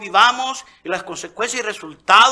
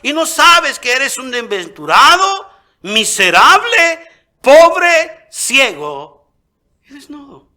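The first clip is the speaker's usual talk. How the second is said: loud, high preacher's fervor; fiery preaching; 5 semitones higher, 7 dB louder than usual. Acted. Preacher exhorting the church, loud fervent sermon. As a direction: preach it hard to the congregation.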